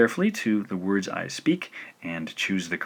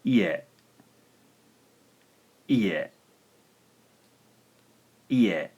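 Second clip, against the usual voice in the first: ie blended at natural speed; natural pace; much slower than usual. Shown as a demonstration. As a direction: say ie as a normal word. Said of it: "spoken at a more natural pace".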